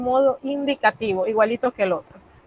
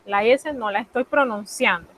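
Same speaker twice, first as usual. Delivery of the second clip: ordinary delivery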